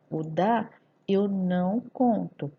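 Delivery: ordinary talk, nothing marked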